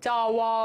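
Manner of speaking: low, level "চাওয়া" with a long ending; fully pronounced. Shown as a demonstration. Teacher repeating the full sound, "চাওয়া" drawn out. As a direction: hold the last vowel of "চাওয়া". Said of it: "পূর্ণরূপে উচ্চারণ হচ্ছে".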